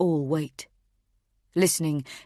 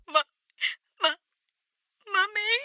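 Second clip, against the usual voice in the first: stuttering, very high crying; a little boy choking on tears; sobbing child; slower than usual. Acted. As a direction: son sobbing for his mother on the phone. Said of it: "Each sob is agonizing".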